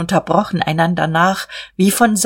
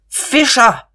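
high, raised-voice call; sudden shout; 7 semitones higher, 4 dB louder than usual. A narrator's reading. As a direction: shout the name suddenly across the room. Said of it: "schrie er plötzlich". (plötzlicher, hoher Aufschrei)

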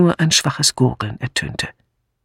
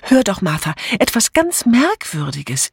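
higher, mildly excited plea; excited urging; 7 semitones higher than usual. A narrator's reading. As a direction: urge her to listen, without shouting. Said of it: "Aufgeregt lief Amy hinter ihr her". (aufgeregt drängend)

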